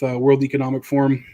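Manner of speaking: ordinary talk, nothing marked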